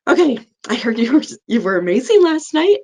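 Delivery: high, quick, bubbly praise for the mom; excited praise. Retold or imitated. Nurse eagerly praising the new mom on her good night.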